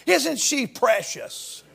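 put-on soft, slow cooing over a baby; tender cooing. Preacher cooing over a little girl, soft and slow. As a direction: coo it like to a child in arms. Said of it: "like we would talk to a little child".

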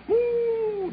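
soft, high drawn-out "whoo"; shivery high "whoo"; high cold-water whoop. Retold or imitated. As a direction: whoop as if icy water hit your toe. Boy reacts to cold water.

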